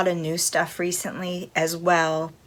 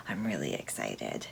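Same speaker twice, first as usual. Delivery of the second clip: soft, low hushed whisper; excited whisper; 14 semitones lower, 9 dB quieter than usual. Acted. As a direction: whisper it like a secret thrill. Excitement repeated in a hush.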